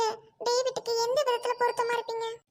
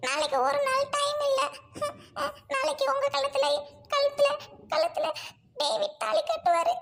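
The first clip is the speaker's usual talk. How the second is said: higher, choked voice that catches on repeated words; tearful breaking plea; 4 semitones higher than usual. Acted. Doctor cries to the bride about tomorrow's wedding, choked and tearful. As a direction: sob out the words as they stick in your throat.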